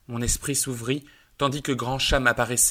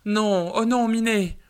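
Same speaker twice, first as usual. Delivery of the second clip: much higher, louder anguished cry; terrified moan; 10 semitones higher, 6 dB louder, slower than usual. Acted. Lady crying out in terror to her dying cat. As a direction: cry out in horror as he suffers. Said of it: "Sa dame épouvantée gémit".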